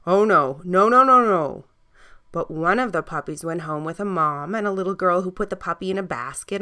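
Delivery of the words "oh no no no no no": firm no, louder on the middle nos; a parent shutting the idea down; parents refusing. A narrator's reading. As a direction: mums and dads refusing a puppy, louder no. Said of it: "mums and dads said the words".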